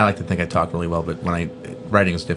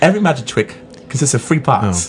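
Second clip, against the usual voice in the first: Cockney-accented film star impression; Michael Caine; 3 semitones higher than usual. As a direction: play up the London accent. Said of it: "do his Michael Caine impression".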